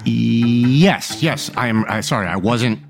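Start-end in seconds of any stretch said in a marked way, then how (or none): none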